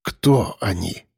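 low, slow hoarse question. A narrator's reading. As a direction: rasp it weakly, throat dry. Weak hero just woke.

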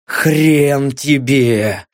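slow, dragged-out defiant thought; stubborn defiance. A narrator's reading. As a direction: spit it out stubbornly. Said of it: "Упрямая мысль".

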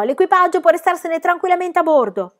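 loud, high-pitched call; shout. A narrator's reading. Pippi tells the crew to stay aboard, shouting. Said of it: "gridò".

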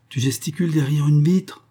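high cry of a question. Acted. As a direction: call it out high, rising at the end. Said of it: "criait-il".

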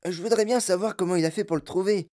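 put-on high, grumbling big-boy voice; sullen cry. A narrator's reading. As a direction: complain sulkily. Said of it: "cria d'une voix maussade".

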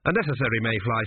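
ordinary delivery, nothing marked

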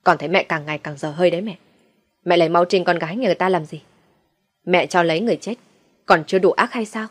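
usual speaking voice

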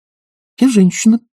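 high-pitched youthful confession; confessing youth. A narrator's reading. Young woman mistaken for a youth admits it, high-voiced confession. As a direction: make a high, small admission in a young voice. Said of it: "признался персиковый юноша".

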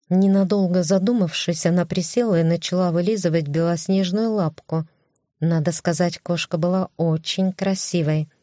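ordinary delivery

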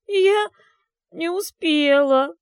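high, loud, wailing through sobs; gurgling sobs. Acted. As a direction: voice bubbling with rising tears. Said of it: "Её голос булькал от подступающих к груди рыданий".

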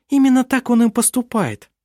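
high, airy comeback; light retort. A narrator's reading. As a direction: toss off a breezy reply. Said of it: "легко парировала Винга".

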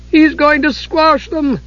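high, slow, panicked voice; alarmed kender cry. A narrator's reading. Tas crying out as the giant nears. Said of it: "Tas cried".

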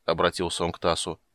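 ordinary delivery, nothing marked

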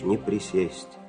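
usual speaking voice

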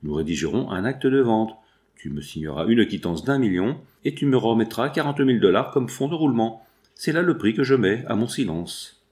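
usual speaking voice

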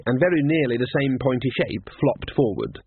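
usual speaking voice

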